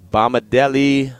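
slow, careful, syllable by syllable; said slowly. Shown as a demonstration. Radio host carefully sounding out a new player's name, slowly. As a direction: sound the name out slowly so you don't butcher it. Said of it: "I have to say it slow".

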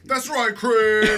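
loud, high hype-man shout; hype man call. Pretend. Hype man confirming host's claim, loud high shout. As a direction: bellow it like a hype man backing the star. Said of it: "Is that right, hype man?".

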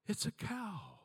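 put-on high little child's voice; toddler voice. Granddaughter announcing a cow from the car seat, high child voice. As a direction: lightly play the small girl in wonder.

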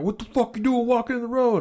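high-pitched mock outrage; imitated angry driver. Driver confronting a man in the road, mock anger.

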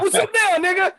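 high loud threatening shout; mock menacing shout. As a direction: yell it like an ambush. Big Boy ambush retold.

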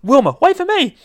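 high, loud cracking plea; voice breaking. Acted. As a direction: call after her with the voice breaking. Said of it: "Tristan started to break a little bit in his voice there".

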